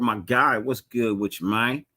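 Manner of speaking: ordinary delivery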